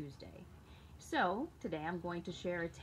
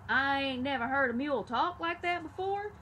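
higher, louder amazed exclamation; exclaimed; 6 semitones higher, 5 dB louder than usual. A narrator's reading. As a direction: burst out in disbelief. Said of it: "And when I exclaimed".

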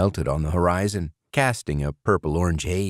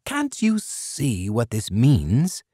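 higher, slow, insistent; animated pressing; 6 semitones higher, slower than usual. A narrator's reading. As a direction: press the point with eager emphasis. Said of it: "Burgum pressed, animated".

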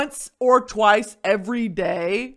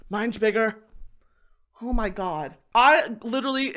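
usual speaking voice

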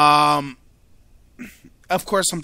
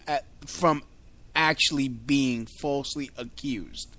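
usual speaking voice

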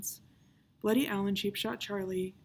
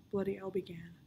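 ordinary delivery, nothing marked

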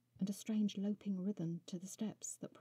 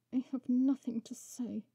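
louder, higher defiant retort through gritted teeth; 6 semitones higher, 8 dB louder than usual. A narrator's reading. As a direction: spit it back defiantly. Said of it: "Coraline gritted her teeth".

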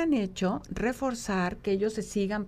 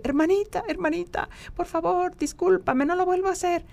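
voz de niño pequeño, más aguda, suplicando perdón a su hermana; 9 semitones higher, 4 dB louder than usual. Retold or imitated. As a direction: imita al nieto rogando, agudo y fuerte. Nieto voiced pidiendo perdón. (nieto que pide perdón a su hermana, voz aguda y suplicante)

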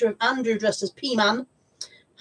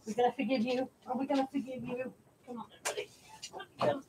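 tender pet talk; 14 dB quieter than usual. Real, not acted. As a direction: coo it gently to the dog. Soothing dog, very soft.

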